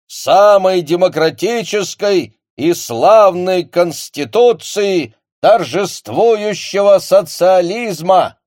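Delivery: loud, slow, high loudspeaker speech; am radio. Acted. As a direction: proclaim it over a public loudspeaker. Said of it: "громче раздавался голос из репродуктора".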